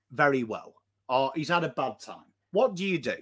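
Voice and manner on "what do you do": ordinary talk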